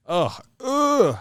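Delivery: high mock groan of disgust. Reacting to a defensive quote, high mock disgusted groan. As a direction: groan as if the talk went sour.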